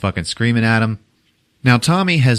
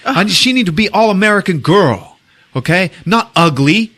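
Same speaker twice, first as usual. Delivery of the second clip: raised, louder mock indignation; imitated indignant complaint; 12 semitones higher, 5 dB louder than usual. Director complains about his actress's looks, mock indignant. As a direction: play an outraged director insisting, voice pitched up.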